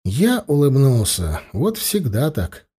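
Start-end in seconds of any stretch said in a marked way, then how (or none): none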